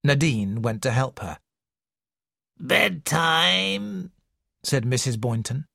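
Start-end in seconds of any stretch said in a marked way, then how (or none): 2.6-4.1 put-on slow, wheezy old woman's voice; slow wheezing invalid complaining to a visitor; wheezing old lady. A narrator's reading. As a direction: wheeze it out like a sickly matriarch. Mrs Boynton's voice.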